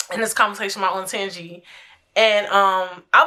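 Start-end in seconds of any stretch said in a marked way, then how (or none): none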